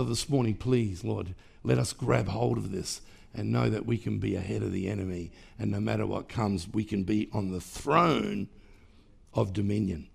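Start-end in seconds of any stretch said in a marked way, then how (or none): none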